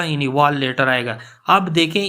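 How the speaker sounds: ordinary talk, nothing marked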